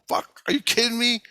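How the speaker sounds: high-pitched retold disbelief; worked-up, incredulous comeback; incredulous protest. Retold protest at refused trip.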